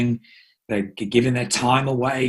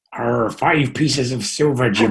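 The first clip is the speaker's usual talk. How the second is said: slow, growly pirate drawl, Arr; slower than usual. Pretend. Host hams up a pirate to the guest, slow mock pirate. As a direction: ham up a pirate captain. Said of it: "my Johnny Depp impression".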